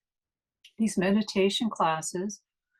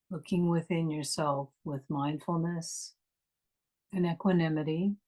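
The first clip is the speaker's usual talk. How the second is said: guided meditation. Acted. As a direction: ease them inward, unhurried and calm.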